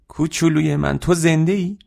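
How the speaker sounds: high, amazed motherly cry; acted joyful astonishment; overjoyed disbelief. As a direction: marvel at your little one in a soaring voice. Mother runs to her living child.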